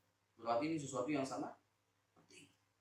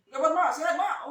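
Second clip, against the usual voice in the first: loud, high calling into the phone to mum; loud phone call home; 12 semitones higher, 11 dB louder than usual. Retold or imitated. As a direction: shout down the phone to mother, Lombok style. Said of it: "memang begini ngomongnya".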